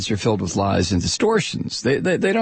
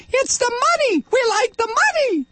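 much higher, sing-song gloating voice; mocking greedy executives.

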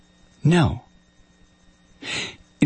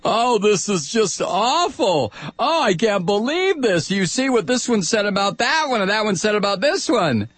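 mocking whiny pundits; 6 semitones higher than usual. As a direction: mimic the whining cable pundits. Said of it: "and they say".

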